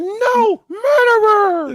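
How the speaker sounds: overacted cub screaming at the killer; hammed-up anguished yell. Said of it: "Simba hopped out when he heard".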